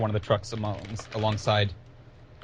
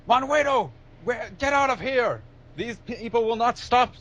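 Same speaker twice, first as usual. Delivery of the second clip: high, urgent shout of warning; captain yelling out in a high raised voice; yelled warning; 11 semitones higher than usual. Acted. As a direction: yell a frantic warning across a distance. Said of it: "he, he yells out".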